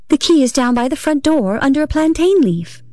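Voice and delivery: high, gentle singsong; patient, sweetly lilting and high. A narrator's reading. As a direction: repeat it gently, pitched well up. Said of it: "very gently and slowly".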